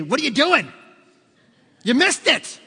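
loud, high mock telling-off; acted-out insulting shout; scolding yell. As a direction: yell it like an exasperated passenger. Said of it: "Doesn't yell at you".

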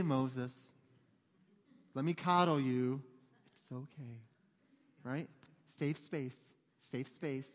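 soft, mock-soothing coo; mock coddling. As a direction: pretend to baby him, softly. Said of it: "that might have been a little far".

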